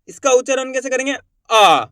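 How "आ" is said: आ said long and forceful, pushed out; stressed long aa. Shown as a demonstration. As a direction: give आ a strong, drawn-out push. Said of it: "इसके उच्चारण में आपको जोर लगाना है". (ज़ोर से खींचा हुआ आ)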